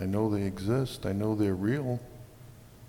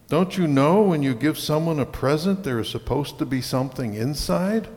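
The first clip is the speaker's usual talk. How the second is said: raised, scolding read-aloud voice; narrated angry yell; 5 semitones higher, 6 dB louder than usual. A narrator's reading. Father scolding his little daughter over an empty box, moderately raised yell. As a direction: read the scolding louder and higher, still as narrator. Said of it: "He yelled at her".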